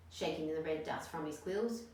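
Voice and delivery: plain reading voice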